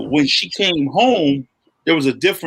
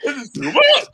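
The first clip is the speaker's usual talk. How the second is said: overwhelmed; 10 semitones higher than usual. Retold or imitated. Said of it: "overwhelmed by episode".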